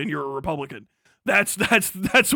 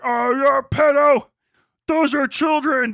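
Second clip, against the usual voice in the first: higher, louder whiny accusation; mock accuser; 6 semitones higher, 7 dB louder than usual. Mimicking people who call him a pedo, higher and louder. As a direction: parody the outraged commenter. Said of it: "People are like".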